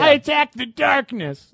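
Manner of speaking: high, drawn-out mock gamer cry; slow, high-pitched nerdy declaration; imitated D&D gamer. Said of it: "That's what it sounds like".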